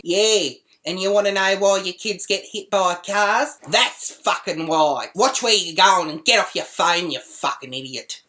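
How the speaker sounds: loud, indignant retold tirade; loud scolding, hammering the swear words; angry telling-off. Acts out berating the woman.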